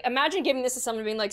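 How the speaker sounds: ordinary delivery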